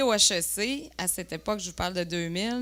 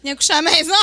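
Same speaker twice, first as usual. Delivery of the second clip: loud, high comic punchline; mock loud invitation; 7 semitones higher, 11 dB louder, much faster than usual. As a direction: belt out the invitation for laughs. Reprend l'invitation pour la salle. (invitation reprise fort, en punch comique)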